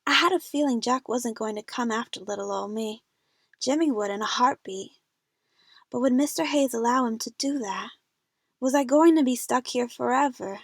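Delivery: ordinary delivery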